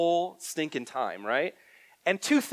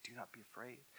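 soft reassurance; 21 dB quieter than usual. Acted. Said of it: "Jesus have to continually say that".

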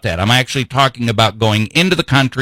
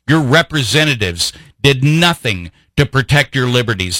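usual speaking voice

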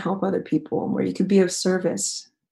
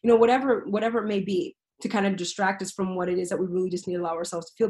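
normal talking voice

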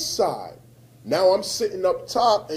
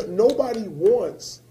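ordinary delivery

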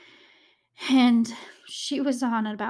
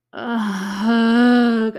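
pained groan; 10 dB louder than usual. Real, not acted. Dreading telling the grim part.

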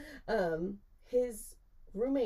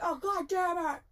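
high-pitched exasperated outcry; acted-out appalled cry; mock dismay; 10 semitones higher than usual. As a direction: imitate a mortified roommate, voice jumping up. Roommate's dismay on seeing her, retold.

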